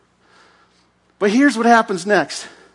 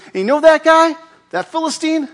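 eager boast; 10 semitones higher, 4 dB louder than usual. Acted. David boasting to Saul.

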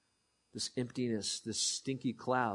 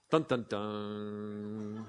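dramatic sting; 5 semitones lower, 3 dB quieter, much slower than usual. Acted.

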